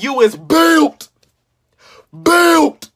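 slow, then "Built" punched loud; slow drawn-out roast. Pretend. Roasting a friend, slow mock drawl into a loud punch. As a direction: drag it out then hit the last word.

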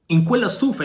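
high, incredulous cry of surprise; astonished exclamation. A narrator's reading. Yanez hearing they will hide in a stove, high astonished cry. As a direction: blurt it out in disbelief. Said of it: "esclamò".

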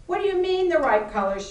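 high, quick, baffled question; confused, annoyed. A narrator's reading. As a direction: ask it in puzzled irritation. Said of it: "sounding genuinely confused and more than a bit annoyed".

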